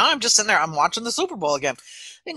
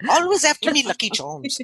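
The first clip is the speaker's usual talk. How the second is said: Irish leprechaun from a cereal ad; high opening, falling away, in hammed-up Irish; mock Irish accent; 5 semitones higher than usual. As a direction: put on a cartoon Irish lilt. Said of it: "There, how was that?".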